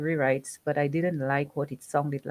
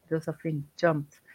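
ordinary delivery

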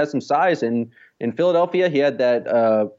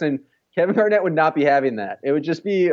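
ordinary talk, nothing marked

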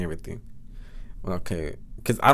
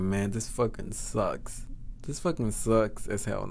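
softer, slow disappointed grumble; inner thought; 3 semitones lower, 6 dB quieter, slower than usual. Retold or imitated. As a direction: grumble it low-key, as if to yourself. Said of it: "In my head, I was like".

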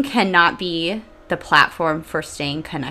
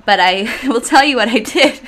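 laughing; 5 semitones higher, 8 dB louder than usual. Real, not acted. Admitting to listeners she doesn't know, laughing. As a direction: laugh through the words, louder and higher. Laughs at her own uncertainty.

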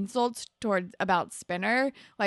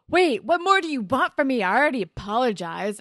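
loud, higher-pitched imitation of an affronted student; retold indignant protest; 4 semitones higher, 7 dB louder than usual. Student protests to the teacher after apologizing, indignant. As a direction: protest loudly, mocking his indignation.

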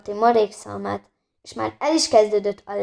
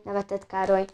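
plain reading voice

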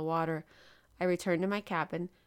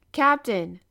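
a still louder call for attention; repeating louder; 4 semitones higher, 10 dB louder than usual. A narrator's reading. Professor calls the captain a second time, louder. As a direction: call him again, much louder. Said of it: "I repeated, nudging him gently".

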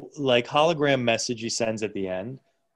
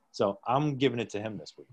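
normal talking voice

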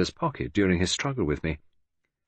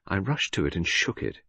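usual speaking voice

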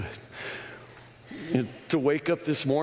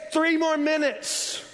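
high, slow, drawn-out shout; preacher yelling the final warning; shouted in your face; slower than usual. Retold or imitated. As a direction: hurl the words at the listener. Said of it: "Shout it in your face".

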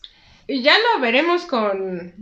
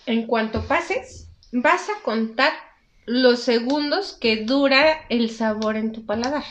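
slow, step-by-step guidance; slow instructions; slower than usual. Shown as a demonstration. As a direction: talk them through it slowly. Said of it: "guides the tasting step".

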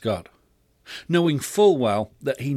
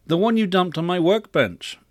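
raised, high-pitched exasperated parent; irritated; 6 semitones higher, 4 dB louder than usual. A narrator's reading. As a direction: scold with growing irritation. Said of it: "more than just irritated".

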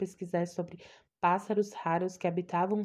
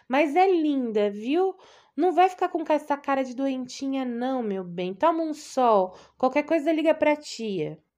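higher, louder fussing aunt; loud, high-pitched caring aunt; doting aunt; 5 semitones higher, 5 dB louder than usual. Acted. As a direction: gush over her like an anxious old aunt. Aunt nagging advice.

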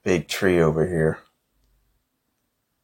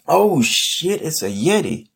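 surprised; 9 semitones higher, 5 dB louder than usual. Real, not acted. A Yeti appears suddenly.

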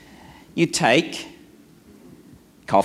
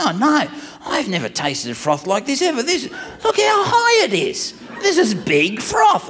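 mock marvelling, climbing high; a touch of hammed-up awe; awed enthusiasm; 8 semitones higher than usual. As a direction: gush over it like a wonder. [name] marvelling over froth height.

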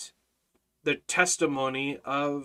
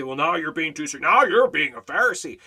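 louder, lightly imitated accuser; mock objector; 7 dB louder than usual. Preacher imitates critics objecting to his strictness, mock accusing. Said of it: "People say".

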